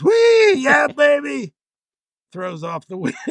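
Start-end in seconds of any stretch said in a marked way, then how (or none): 0.0-1.5 high, loud hammed-up whoop; Farley voice. Farley dropping Brando back into his own yell. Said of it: "returns to the Chris Farley voice".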